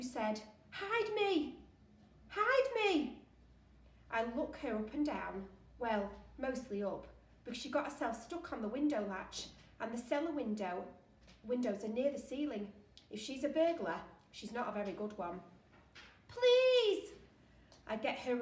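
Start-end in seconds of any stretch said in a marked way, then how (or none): 0.7-3.2 high, loud desperate cry; urgent plea. Acted. Girl scrambling through a window, urgent high plea. 16.3-17.1 high, loud, drawn-out begging cry; desperate plea. Acted. Girl stuck in the window, desperate loud plea.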